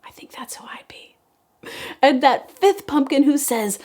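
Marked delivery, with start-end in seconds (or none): 0.0-1.2 fast confiding whisper. Real, not acted. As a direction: share a little secret. Confiding aside to child.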